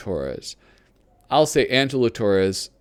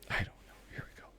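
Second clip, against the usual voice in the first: whispering; 19 dB quieter, much faster than usual. Real, not acted. Quiet aside while turning pages.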